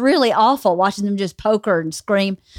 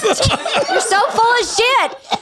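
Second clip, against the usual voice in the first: higher, laughing disbelief at his lie; laughing protest; 10 semitones higher than usual. Real, not acted. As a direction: call him out while cracking up. Wife laughing, calling him out.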